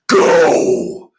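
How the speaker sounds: loud, sharp barked go; thundering command. Acted. Dragon ordering the tiger away, loud command. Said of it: "Dragon commanded again in his thundering voice".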